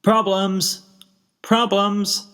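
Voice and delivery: high, flat call to passing crowd; hawking it without enthusiasm. A narrator's reading. Spike calling to passing ponies, flatly. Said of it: "Spike called out flatly".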